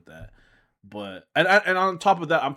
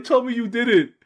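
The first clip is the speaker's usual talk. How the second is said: loud, high plea on the edge of laughter; high-pitched, raised-voice begging; laughing disbelief; 11 semitones higher, 9 dB louder than usual. Retold or imitated. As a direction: retell it worked up and loud.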